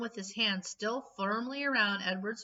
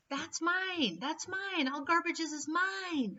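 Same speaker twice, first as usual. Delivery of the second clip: high frantic cry of ownership; possessive shouting; 9 semitones higher, slower than usual. A narrator's reading. Garbage king yelling after the fleeing dog. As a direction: scream it like a mad king. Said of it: "Ernest shouted".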